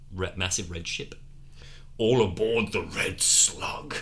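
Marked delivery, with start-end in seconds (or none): 2.0-3.9 slow lisping voice. Acted. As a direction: lisp through broken teeth. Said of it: "Bond speaks like this 'cause all his teeth are".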